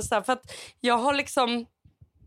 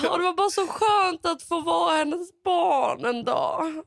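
real tearful voice, higher and slower; choked up, pitched higher; tearful emotion; 6 semitones higher, slower than usual. Real, not acted. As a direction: speak through welling tears. Moved to tears while telling.

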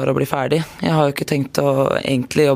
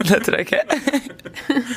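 higher, laughing through the words; laughing refusal; 8 semitones higher, much slower than usual. Real, not acted. Player turning down the hosts' request for Kristiansand dialect, laughing.

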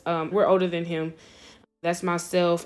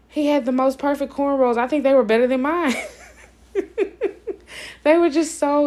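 higher, louder, delighted and amazed; 7 semitones higher, 4 dB louder than usual. Real, not acted. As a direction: gush with real delight. Delighted by brother's cornrows.

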